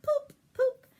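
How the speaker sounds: high, loud popping noise; imitated bottle rocket poop; rocket pops. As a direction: pop it out like a firework.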